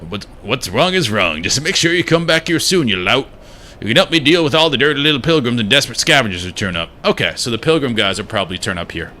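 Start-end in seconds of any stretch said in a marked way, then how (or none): 0.1-6.9 lightly put-on Southern character voice; loose, improvised Southern accent. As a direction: grumble with a touch of Southern accent. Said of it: "Now he's southern".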